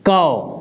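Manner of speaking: कौ said as "kau"; teacher sounding out "कौ"; ka with au matra. Shown as a demonstration. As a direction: model "कौ" clearly. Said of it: "क्या पढ़ेंगे?".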